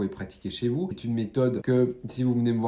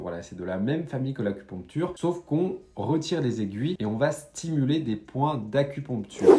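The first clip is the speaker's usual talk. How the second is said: ordinary talk, nothing marked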